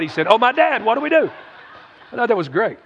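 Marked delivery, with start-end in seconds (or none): 0.3-1.3 high-pitched pretend panic; hammed-up alarm; mock freak-out. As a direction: replay the flustered cry, pitched up. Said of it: "Jesus wasn't freaking out going".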